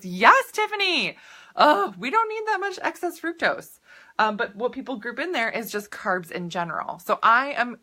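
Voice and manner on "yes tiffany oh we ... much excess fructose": high, slow and louder, greeting a viewer; excited reply. Real, not acted. Answering Tiffany's chat comment, excited and higher. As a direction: call out the viewer's name with delight.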